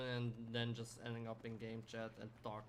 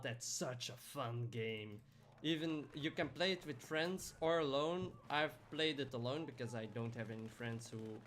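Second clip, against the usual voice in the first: ordinary talk, nothing marked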